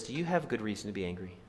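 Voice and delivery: low, soft, measured-down voice; the Lord's question. Acted. The Lord questioning angry Jonah, softly. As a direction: ask it quietly, pitched low. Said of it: "And now the Lord says".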